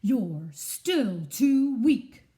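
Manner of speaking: very slow, loud, drawn-out sneer; haughty eagle dismissing the small squirrel; scornful eagle. Acted. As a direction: look down your beak at him. Eagle mocks again.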